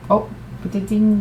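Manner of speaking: talking mid sip; while sipping a drink. Real, not acted. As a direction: clink glasses while sipping. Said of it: "Mid sip".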